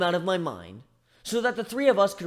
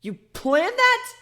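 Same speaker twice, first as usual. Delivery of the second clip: much higher, louder and slow, stunned; shocked disbelief; 6 dB louder, much slower than usual. Acted. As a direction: stretch out the disbelieving question. Shocked discovery of drunk plan.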